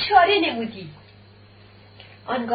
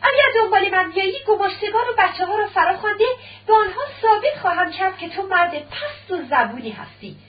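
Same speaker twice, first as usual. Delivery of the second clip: shouting; 6 semitones higher than usual. A narrator's reading. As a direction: shout the threat back at him. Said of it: "داریا فریاد زد".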